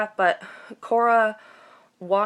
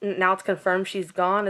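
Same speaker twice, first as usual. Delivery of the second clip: ordinary talk, nothing marked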